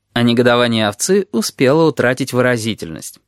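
usual speaking voice

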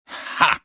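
sharp voiceless huff; unvoiced scoffing puff of air; exhaled "ha". A narrator's reading. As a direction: breathe out a curt "ha". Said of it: "выдохнул вместо ответа".

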